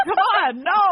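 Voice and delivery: loud, high, laughing no; mock firm no; laughing refusal. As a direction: shut down the toddler, laughing. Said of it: "I'm like".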